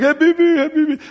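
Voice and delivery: high, loud mock Arabic greeting "Wallah, Habibi"; playful Arabic-accented hail; Middle Eastern greeting. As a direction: call out like a friendly street greeting in the Middle East. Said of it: "So you're always like".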